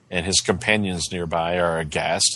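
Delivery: ordinary talk, nothing marked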